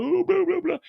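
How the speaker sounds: high mocking falsetto of a nagging wife; mocking his wife. Man mimicking wife's complaints in high mocking voice. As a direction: parody her whiny chatter up high. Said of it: "She talking about".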